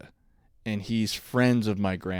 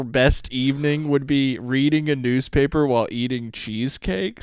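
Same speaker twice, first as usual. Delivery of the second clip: usual speaking voice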